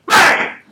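sharp, loud, breathy mock gunshot bang; gunshot noise. Imitating the kid's gun firing, loud voiceless bang. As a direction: spit out a bang like a gun going off.

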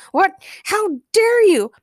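high, slow, loud indignation; drawn-out cartoon outrage; outraged shout. A narrator's reading. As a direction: cry out as an affronted villain. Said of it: "Penguin's shouts".

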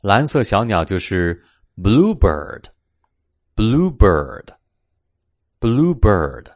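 ordinary delivery, nothing marked

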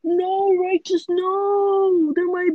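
high, loud playful wail; mock-distraught protest. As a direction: drag out the no in mock despair. Playful protest at threatened pet theft.

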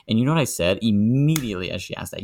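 usual speaking voice

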